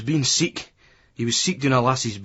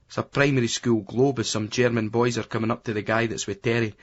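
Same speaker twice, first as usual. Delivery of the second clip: usual speaking voice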